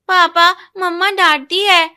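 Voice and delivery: put-on high, loud, slow little boy complaint; tattling child. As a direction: tell on mom to dad like a small kid. Son complains to father. (पापा से mummy की शिकायत करता छोटा बच्चा)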